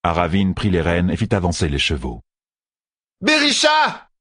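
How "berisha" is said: very high-pitched shouted name; urgent cry. A narrator's reading. Fail calling to the kneeling sister, crying out. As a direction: call out to her, high and urgent. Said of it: "cria Fail".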